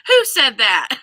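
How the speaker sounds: laughing through the question; amused outburst. Real, not acted. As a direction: giggle while asking. Laughing at the rude comment.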